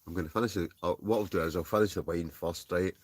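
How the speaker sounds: ordinary delivery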